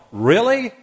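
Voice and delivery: incredulous. Real, not acted. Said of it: "incredulous reaction".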